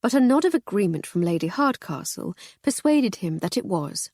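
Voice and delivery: usual speaking voice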